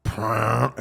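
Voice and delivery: rolled rumbling noise; imitated stomach rumble. Shown as a demonstration. Explaining an indoor fart to friends, with an imitated stomach rumble. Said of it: "in your stomach, it goes like".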